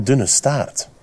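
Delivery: usual speaking voice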